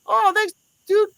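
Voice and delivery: very high, bright-eyed thank you; chirpy thanks. Retold or imitated. Thanking the clerk for the soda, very high and chirpy. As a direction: squeak it out like a grateful kid.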